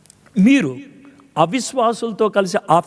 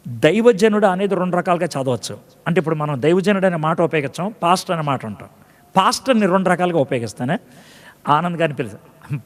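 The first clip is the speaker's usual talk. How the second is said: ordinary delivery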